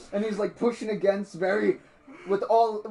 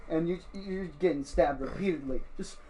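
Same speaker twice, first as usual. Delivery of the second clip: ordinary delivery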